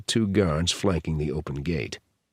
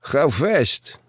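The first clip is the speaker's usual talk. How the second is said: put-on higher-pitched Russian-accented question; Russian-accented guard, pitched up; 6 semitones higher than usual. A narrator's reading. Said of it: "said in Russian".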